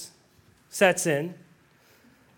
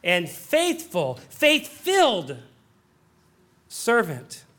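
God's slow blessing; 4 semitones lower, much slower than usual. Retold or imitated. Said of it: "God will say".

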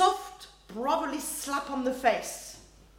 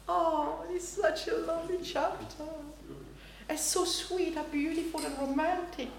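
gushing, higher and softer; sentimental; 4 semitones higher, 4 dB quieter than usual. Retold or imitated. Sentimental listener gushes over the love chapter, gushing. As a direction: swoon over the chapter, lightly mocking. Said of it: "one who is very sentimental".

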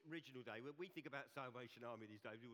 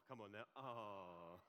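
slow, drawn-out ah with more voice; bigger ah; 4 semitones lower, much slower than usual. Shown as a demonstration. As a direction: stretch the ah out longer and fuller. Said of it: "that wasn't a big enough ah".

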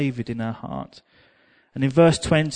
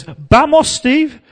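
high, loud call of Vamos, Steve; excited shout; 10 semitones higher, 10 dB louder, much slower than usual. Retold or imitated. Sister-in-law shouts to Steve to come dance. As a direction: call him onto the floor, loud and high. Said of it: "she goes".

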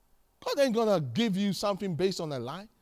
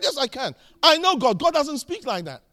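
loud, higher-pitched arguing back; forceful retold comeback; defiant retort; 5 semitones higher, 8 dB louder than usual. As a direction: re-enact standing up to dad at full voice. Retold argument with his angry dad.

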